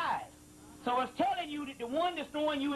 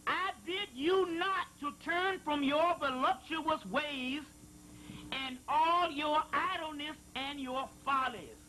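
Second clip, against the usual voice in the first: higher, slow, solemn intoning; scripture reading; 4 semitones higher, slower than usual. Acted. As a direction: read it as holy prophecy. Reading a sacred passage aloud.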